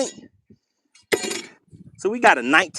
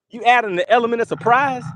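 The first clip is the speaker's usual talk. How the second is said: normal talking voice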